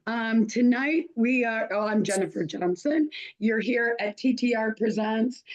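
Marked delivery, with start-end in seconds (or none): none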